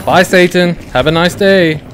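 loud high taunting goodbye; mocking farewell. Real, not acted. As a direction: wave him off with a sneer. Taunting the dead enemy.

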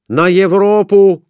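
loud, high call; shouting. A narrator's reading. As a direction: shout the bet. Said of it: "кричал Конурин".

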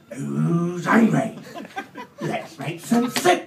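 loud, high, very slow hearty call; hearty ladle. Acted. Ladle showing off in the kitchen, loud hearty call. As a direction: call everyone to dinner with gusto. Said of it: "he's giving it the big one".

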